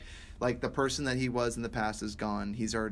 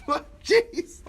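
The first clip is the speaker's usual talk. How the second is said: startled jeez; 9 dB louder than usual. Retold or imitated. Retold near miss shock.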